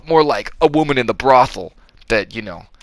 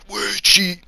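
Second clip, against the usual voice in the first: high mock voice; 10 semitones higher, 4 dB quieter than usual. Cage voiced in retelling.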